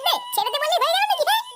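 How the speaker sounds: high shouted urging; egging on shout. Acted. Friend shouts at Paltu to keep beating the boy.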